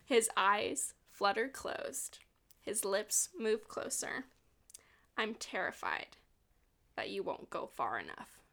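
slow, soft romance reading; hushed, deliberate book narration; dramatic reading. A narrator's reading. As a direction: read it softly, savoring each phrase. Reads book passage aloud.

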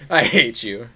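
ordinary talk

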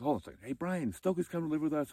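loud, high call across the house, rushed. Retold or imitated. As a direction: shout the news to the next room, quickly. Barry calls out to housemate.

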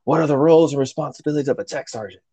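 retold angry demand. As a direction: imitate a chief firing off a pissed-off question. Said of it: "he yells at him again".

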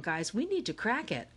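plain reading voice

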